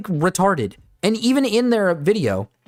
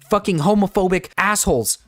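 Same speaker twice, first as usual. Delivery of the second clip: ordinary talk, nothing marked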